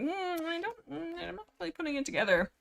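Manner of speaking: high, soft dithering; mock clueless. Imitating Fireheart missing obvious hints, mock clueless. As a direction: play dim in a small high voice. Mocking Fireheart's failure to catch on.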